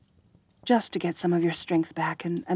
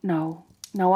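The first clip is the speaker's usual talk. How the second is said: ordinary delivery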